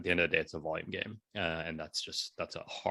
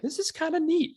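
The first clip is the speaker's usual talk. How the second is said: squeaky high delighted aside; high-pitched playful self-quote; mock excited inner voice; 3 dB louder than usual. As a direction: jump way up high on this. Self-quote of past thought.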